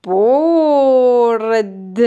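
چوپان گوسفندان را به چراگاه برد stretched out word by word; slow dictation. Shown as a demonstration. Teacher dictating slowly so the child can write each word. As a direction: drag each word out with long gaps.

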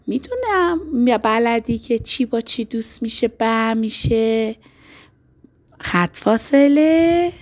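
ordinary talk

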